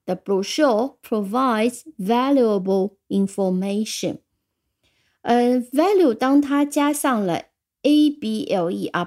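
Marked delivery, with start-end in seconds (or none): 0.1-4.2 slow model sentence. Shown as a demonstration. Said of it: "所以这句句子我们把它记住".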